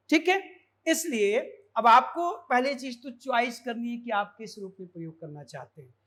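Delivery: normal talking voice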